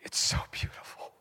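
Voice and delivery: breathless whisper of a wrecked man; hushed, awed, shattered whisper; broken whisper. Retold or imitated. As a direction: whisper it like a tearful confession. Broken addict confessing through tears.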